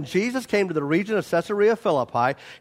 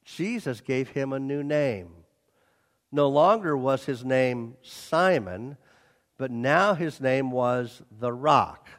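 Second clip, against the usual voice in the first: solemn; 5 semitones lower, 4 dB quieter than usual. Real, not acted. Solemn emphasis.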